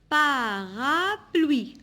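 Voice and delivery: "Parapluie" very slow and loud, pa, ra, pluie; syllable by syllable. Shown as a demonstration. Teacher counting three syllables for pupils, syllable by syllable. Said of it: "Trois syllabes".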